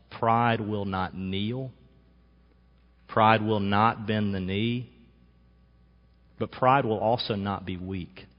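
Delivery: slowed, stress on pride; deliberate, each word weighed; sermon point driven home slowly; slow emphatic preaching. Real, not acted. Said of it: "preacher slowing for emphasis".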